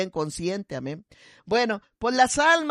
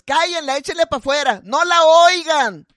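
loud heckle; 5 semitones higher, 7 dB louder than usual. Retold or imitated. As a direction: shout them down, dragging the last words. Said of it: "con voz bien fuerte decía".

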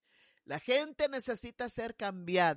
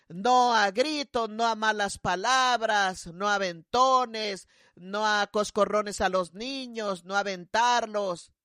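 each no hammered out slowly and louder; emphatic list; 5 dB louder, slower than usual. Real, not acted. Pastora to the congregation, slow emphatic listing. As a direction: drive home every forbidden thing, slow and firm. Preacher listing forbidden behaviors.

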